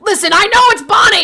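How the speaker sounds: loud retort. Real, not acted. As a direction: shout back that you know.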